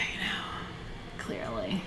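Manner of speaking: soft unvoiced whisper of clearly. Real, not acted. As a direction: breathe clearly as a sly aside.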